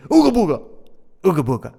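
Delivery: loud, high mock caveman chant; hammed-up caveman yell; caveman grunt. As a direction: ham up a dumb brute. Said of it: "He would say".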